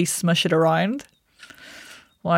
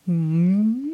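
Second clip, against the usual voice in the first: gentle chewy hum. Shown as a demonstration. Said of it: "chewy hum".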